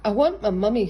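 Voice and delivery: loud, wailing little sob; sobbing. A narrator's reading. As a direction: cry for his mummy. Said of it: "sobbed Owl".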